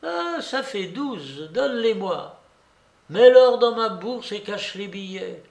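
put-on high, gloating old lady; jubilant old babouchka. A narrator's reading. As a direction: count your winnings aloud with relish.

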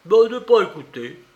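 bad Russian, foreign speaker. A narrator's reading. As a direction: blurt it like a foreigner with poor Russian. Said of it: "répondit en mauvais russe".

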